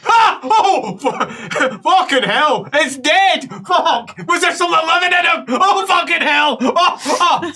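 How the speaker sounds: high, loud exclamations broken by laughter; acted hysterical outburst. Neighbour reacting to what was removed, acted shocked laughing shouts. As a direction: crack up in shocked disbelief. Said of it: "you hear the other side".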